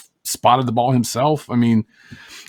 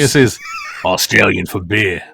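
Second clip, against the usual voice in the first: lightly voiced Australian beer-ad line; a hint of the Foster's commercial accent; 4 semitones lower than usual. Co-host quotes beer ad in light Australian accent.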